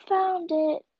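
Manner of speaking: high, loud game call; retold yell. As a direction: call it out like a kid winning. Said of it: "and yell".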